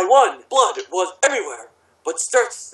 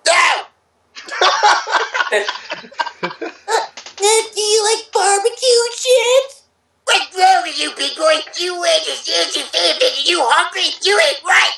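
high mock-scolding voice; 11 semitones higher than usual.

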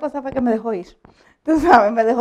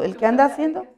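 ordinary talk, nothing marked

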